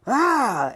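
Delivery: high, loud "aah" scream; re-enacted scream of pain; retold labor scream. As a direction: act out crying out while pushing. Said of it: "I screamed as loud as I could".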